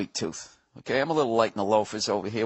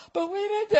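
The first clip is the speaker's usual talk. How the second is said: much higher squeaky objection; mock pleading protest. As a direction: whine like a helpless innocent. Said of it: "I said".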